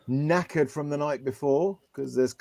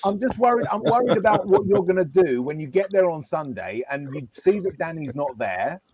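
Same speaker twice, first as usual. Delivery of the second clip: higher, hammed-up consoling; mock sympathy; 7 semitones higher than usual. Consoling heartbroken friend Nick with mock sympathy. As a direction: overplay the concern.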